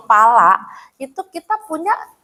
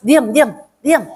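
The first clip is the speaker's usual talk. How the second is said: acted parent's hushing scold; mildly stern telling-off; mock scolding; 3 semitones higher, slower than usual. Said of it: "kalau kita bentak tambah nangis".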